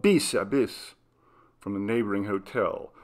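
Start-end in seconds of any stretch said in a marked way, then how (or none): none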